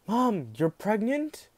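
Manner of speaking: surprised realisation. Retold or imitated. Daughter realises mother is pregnant.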